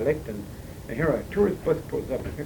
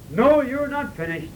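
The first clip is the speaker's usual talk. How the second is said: higher, slow, insistent "No, you're not finished"; echoing insistence; 8 semitones higher, 3 dB louder, slower than usual. Retold or imitated. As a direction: call it after him as the car pulls away. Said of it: "so echoed and so rang in my ears".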